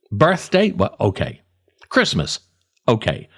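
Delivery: ordinary talk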